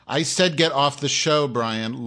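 loud, slowed command; yelling at a troll in chat, furious; angry yell. Real, not acted. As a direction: shout him off the show.

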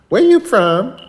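high, loud cashier's question; nosy cashier. Retold or imitated. Feisty cashier questioning customers, nosy high-pitched voice. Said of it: "She goes".

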